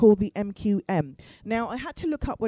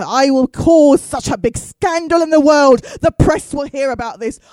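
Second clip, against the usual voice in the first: mock grand threat; 3 semitones higher, 11 dB louder, slower than usual. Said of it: "they've really bought into".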